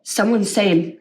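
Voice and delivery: usual speaking voice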